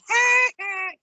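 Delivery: high, loud imitated "ha ha"; Simpsons-style mocking laugh. Host likening Joshua's comeuppance to a cartoon, mocking laugh. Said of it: "it's like the Simpsons".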